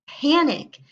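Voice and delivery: loud, punchy cry of alarm; dramatic exclamation. A narrator's reading. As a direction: call it out like an alarm bell. Narrator exclaims panic.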